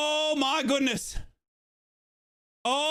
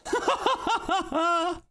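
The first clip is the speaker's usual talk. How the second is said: breathless laugh; 5 semitones higher than usual. Real, not acted. Laughing after celebration.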